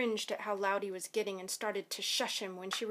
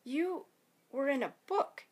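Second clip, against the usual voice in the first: higher, halting accusation; stunned disbelief; 5 semitones higher than usual. A narrator's reading. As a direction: pause after the first word, astonished. Boy stunned by what he saw.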